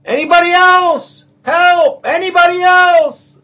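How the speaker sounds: very high, loud panicked shouting; shrill comic panic, loud and high; desperate crying out. Acted. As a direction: shout to anyone but God. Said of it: "the man starts crying".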